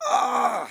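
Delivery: sudden high yelp of pain. Acted. Man on the ladder sees his broken leg, sharp high pained yelp. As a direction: let out a sharp cry as you see the leg.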